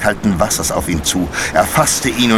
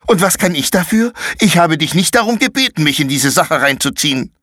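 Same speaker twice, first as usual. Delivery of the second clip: put-on much higher, sulky angry boy; defensive anger; 9 semitones higher than usual. Konrad angrily refuses the blame.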